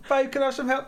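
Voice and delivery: playful put-on request; mock pleading wife. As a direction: imitate a partner asking for help. Husband acts out wife asking.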